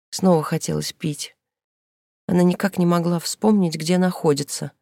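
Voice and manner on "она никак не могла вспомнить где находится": ordinary delivery, nothing marked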